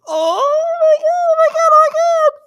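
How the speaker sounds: very high, slow cringing squeal. Retold or imitated. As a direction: squeak it out in horror. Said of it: "and you go".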